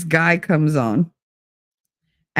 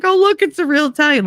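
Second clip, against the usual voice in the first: a quick, higher-pitched burst of delight; excited, fast and high; 9 semitones higher, faster than usual. Retold or imitated. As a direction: gush that you've spotted a real Italian. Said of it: "'cause I was so excited".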